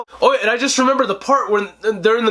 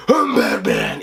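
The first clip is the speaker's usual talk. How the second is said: gravel-deep Batman growl, much lower; slower than usual. Retold or imitated. Reviewer imitating Bale's Batman, deep growl. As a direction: growl it from the chest.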